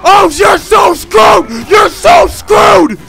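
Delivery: very slow, high, loud sing-song jeer; mocking taunt. Real, not acted. Gamer jeering as his teammate is doomed, slow loud taunt. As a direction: drag it out mockingly.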